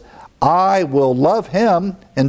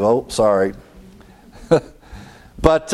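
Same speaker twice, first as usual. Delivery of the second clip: ordinary talk, nothing marked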